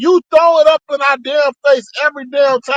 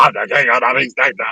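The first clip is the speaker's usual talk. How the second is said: low, fast mocking voice; mocking quote; 9 semitones lower, faster than usual. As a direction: sneer it out like a braggart. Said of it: "Talking about".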